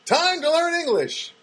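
high, drawn-out title call; show title announcement. Pretend. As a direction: proclaim it like a reality show name. Proclaims his reality show title.

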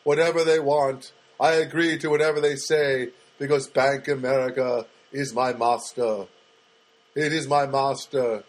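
a hammed-up slave vowing obedience to his master; mock servile. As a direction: grovel to the bank in sarcastic surrender. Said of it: "And I started going".

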